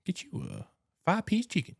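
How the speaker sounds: high imitation of the Cajun Popeyes ad lady; Popeyes lady accent. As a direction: voice the chicken-ad lady selling you a meal. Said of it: "that lady on the Popeyes commercials".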